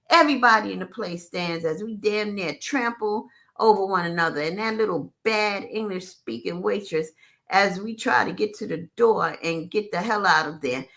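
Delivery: usual speaking voice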